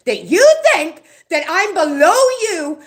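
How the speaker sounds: loud, high, half-laughing shout; real outrage breaking into a loud laugh; indignant outburst. Real, not acted. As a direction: spit out the insult with a furious laugh. Furious rant about being insulted.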